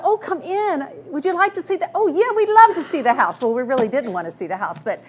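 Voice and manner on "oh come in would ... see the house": high, hammed-up social enthusiasm; eager polite welcome. Hostess and guests at the door, mock-eager politeness. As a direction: act out gushing politeness, pitched well up.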